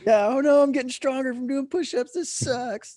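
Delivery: high, sarcastic mock complaint; sarcastic whine. Sarcastic complaining about push-ups.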